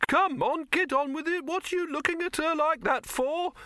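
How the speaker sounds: high-pitched, irritated character voice; impatient Silverfish. A narrator's reading. Silverfish chivvying the others along, high and impatient. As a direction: nag in a high, put-on voice.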